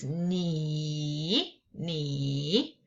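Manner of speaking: ni dipping down then up, very slow; teacher showing the dipping third tone ni. Shown as a demonstration. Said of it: "goes down and goes up".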